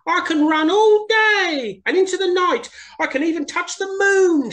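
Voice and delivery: high, loud bragging hare voice; boastful Hare. Acted. Hare bragging to the other animals, high and loud. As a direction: show off to everyone. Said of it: "boasted Hare".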